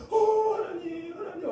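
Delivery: high, drawn-out wail to God; mother's wailing prayer, acted out; imitated desperate prayer. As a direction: chant it slowly, imploring God. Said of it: "어, 기도해".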